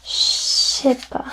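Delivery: je sais pas slurred to shé pa; very casual French. Shown as a demonstration. Teacher shows the most casual form. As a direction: collapse it to shépa. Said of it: "casual is".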